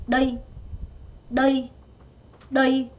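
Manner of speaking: 너희 stressed on 너, not dropping; 너희 with stress up front; stress on the first syllable. Shown as a demonstration. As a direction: put the weight on 너 in 너희. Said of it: "여기에 강세가 들어와요".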